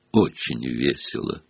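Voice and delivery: low, soft, sour; gloomy. A narrator's reading. Nick sourly answering Sir Patrick, low and glum. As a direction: say it dryly and glum. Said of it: "мрачно произнёс".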